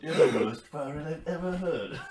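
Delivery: giggly put-on British voice; British accent. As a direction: quote the film in a hammed-up British accent. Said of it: "is that a British accent?".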